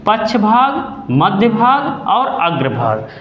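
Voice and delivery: slow, clear naming of tongue parts; slow listing. Shown as a demonstration. Teacher pointing out tongue parts slowly to class. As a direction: enumerate each भाग slowly. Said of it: "देखिए ये जीभ है".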